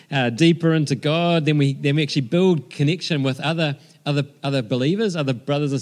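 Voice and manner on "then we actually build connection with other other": usual speaking voice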